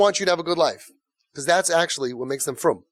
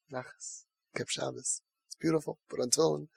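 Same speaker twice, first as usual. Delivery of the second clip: hushed, near-whispered savoring, much softer; 11 dB quieter than usual. Retold or imitated. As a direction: breathe the words in quiet awe. Parent quietly savoring child's progress.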